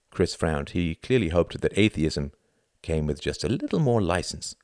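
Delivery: usual speaking voice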